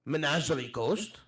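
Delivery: polite foreigner with careful lilt; accented traveller. Acted. Addressing the pirate captain, polite accented traveller. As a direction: ask courteously with a foreign accent.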